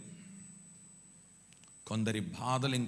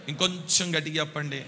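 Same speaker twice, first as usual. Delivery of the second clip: urging louder; 3 semitones higher, 7 dB louder than usual. Real, not acted.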